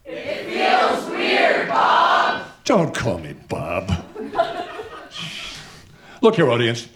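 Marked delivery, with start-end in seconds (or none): 0.1-2.4 slow breathy heckle; whispering. Acted. Crowd member heckling. 2.6-3.9 low, slow irritated retort; annoyed. Acted. Official irritated by heckler.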